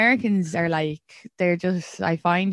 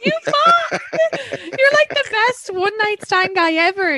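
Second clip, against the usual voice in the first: much higher, louder, delighted outburst; excited teasing yell; 13 semitones higher, 4 dB louder, slower than usual. Real, not acted. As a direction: shout it at him in disbelief. Friend teasing, laughing outburst.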